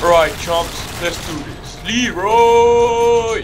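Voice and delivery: loud rallying battle yell; Leroy Jenkins. Retold or imitated. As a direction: charge in shouting the meme. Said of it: "Someone knows the Leroy".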